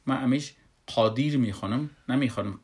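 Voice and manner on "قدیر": قادر with a dark, rounded "qo" opening; thick back q in قادر, as "qodir"; weighty first syllable on قادر; full, heavy qaf. Shown as a demonstration. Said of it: "پر میخوانمش".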